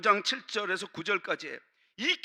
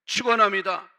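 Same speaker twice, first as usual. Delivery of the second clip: emphatic blessing; 7 dB louder than usual. Real, not acted.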